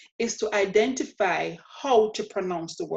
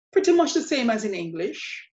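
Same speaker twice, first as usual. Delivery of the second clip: ordinary delivery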